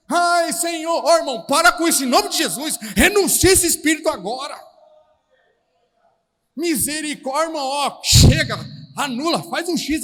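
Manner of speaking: loud, high rebuking of a spirit; forceful deliverance-prayer shout; loud rebuke. Real, not acted. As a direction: cast it out in Jesus' name.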